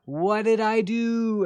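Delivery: high, slow, wounded innocence; mock-clueless friend whining; Soma impression. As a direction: ask it like you did nothing wrong. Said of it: "he'll be like".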